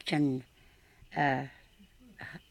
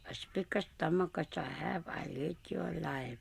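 softer, almost whispered menace; hushed threat; 8 dB quieter than usual. A narrator's reading. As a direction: breathe the threat at the fish. Boy threatens the fish.

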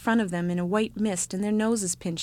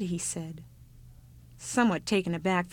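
plain reading voice

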